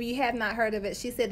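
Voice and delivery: ordinary talk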